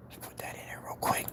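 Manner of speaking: unvoiced whisper while working on the label; whisper to self. Real, not acted. Designing the label alone, whispering to himself. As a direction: breathe the words out under his breath.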